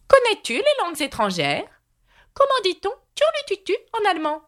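high-pitched quizmaster queen; examining Red Queen. A narrator's reading. Red Queen testing Alice on languages, high demanding voice.

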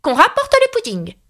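high-pitched commanding cry. A narrator's reading. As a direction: call out an order like a queen. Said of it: "s'écria". (s'écrier d'une voix très aiguë)

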